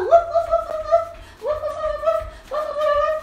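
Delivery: very high little whoops; running puppy yips. Pretend. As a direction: yip like a small dog dashing along. Pretend dog running again.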